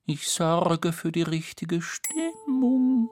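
high, slow, level sung tone; slow high monotone; tuning fork voice. Acted. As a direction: chant it high and steady. Tuning fork character speaks.

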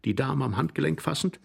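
plain reading voice

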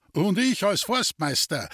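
high-pitched merry boast; amused joining in. A narrator's reading. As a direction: chime in cheerfully, pitched high. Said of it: "lachte der Forstwart".

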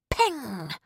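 high, ringing "Peng" falling away; imitated gunshot. A narrator's reading. Narrator voicing Rufus shooting at the bottle, imitated gunshot. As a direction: fire off the word like a shot.

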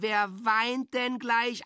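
put-on high, slow, sing-song baby talk; mock coaxing a child. An adult mockingly soothing a child about to cry, high and slow.

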